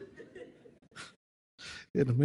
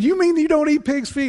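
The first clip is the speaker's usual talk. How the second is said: high, loud, stunned question; amazed disbelief; 9 dB louder than usual. Retold or imitated. Neighbor amazed his friend won't eat pig's feet. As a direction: sound shocked he refuses pig's feet.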